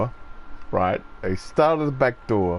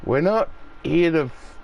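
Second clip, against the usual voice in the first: ordinary talk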